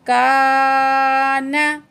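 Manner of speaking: chanted syllables; drawn-out recitation. Shown as a demonstration. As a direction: hold every vowel in का ना.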